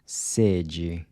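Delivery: sede with a short, tight E, like "say"; sede, E kept closed. Shown as a demonstration. Teacher models Portuguese sede with a closed E. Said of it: "closed E".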